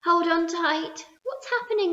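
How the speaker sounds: alarmed cry. A narrator's reading. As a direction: cry out as the floor heaves. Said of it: "cried James".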